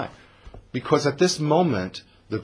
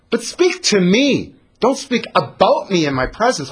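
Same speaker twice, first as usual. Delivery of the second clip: indignant; 9 semitones higher, 5 dB louder than usual. Acted. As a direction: insist they face her. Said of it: "What a chutzpah".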